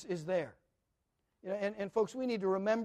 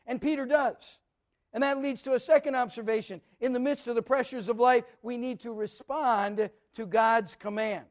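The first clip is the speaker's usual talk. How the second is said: louder, rising sermon delivery that builds in intensity; full-force preaching; 4 semitones higher, 8 dB louder than usual. Acted. Pastor urging his congregation to see Jesus, full-force preaching.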